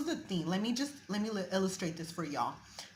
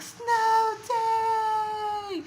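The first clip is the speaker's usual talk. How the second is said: high, ringing cry of joy; excited shout; 8 dB louder than usual. Retold or imitated. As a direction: shout it like a kid who just got out of school. Mimicking excited kids in a movie.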